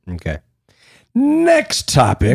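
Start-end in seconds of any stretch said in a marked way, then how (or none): 1.1-2.4 slow, high announcement; drawn-out announcer. Acted. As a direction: draw out the words mockingly.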